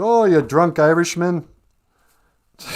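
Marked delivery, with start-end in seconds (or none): 0.0-1.5 mock taunt. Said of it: "It's like".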